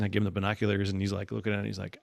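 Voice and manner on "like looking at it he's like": normal talking voice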